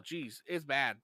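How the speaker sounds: ordinary delivery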